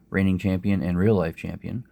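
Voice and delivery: normal talking voice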